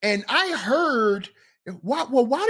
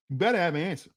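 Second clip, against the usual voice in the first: lower, softer warning; quieter repeat; 5 semitones lower, 6 dB quieter, much faster than usual. Shown as a demonstration. Warning the fellas again, low and soft. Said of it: "repeat quieter".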